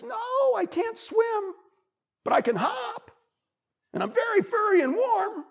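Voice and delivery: very high, small bunny voice; pleading bunny. A narrator's reading. Bunny tells beaver he can't swim, pleading. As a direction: pipe up your case in halting pieces.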